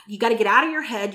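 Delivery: ordinary delivery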